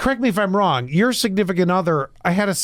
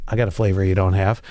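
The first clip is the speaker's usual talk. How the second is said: low fast challenge; 11 semitones lower, faster than usual. Acted. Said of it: "Guy goes".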